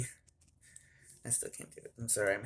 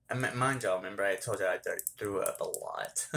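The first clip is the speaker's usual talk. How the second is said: ordinary talk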